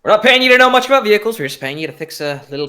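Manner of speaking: high, loud, rapid retort; impatient retort. Acted. Gearfried dragging acolyte along, high and impatient.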